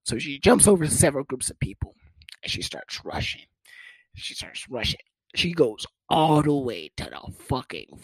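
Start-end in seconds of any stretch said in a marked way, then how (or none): 2.4-5.0 hushed telling. Real, not acted. Hushed dramatic retelling.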